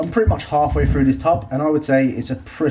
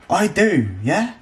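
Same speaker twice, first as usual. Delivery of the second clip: mock defiance; 7 semitones higher, slower than usual. Defiant reply to imagined critic.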